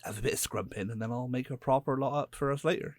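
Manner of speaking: a light rustic West Country farmer voice; West Country accent. Retold or imitated. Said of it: "I went proper West Country".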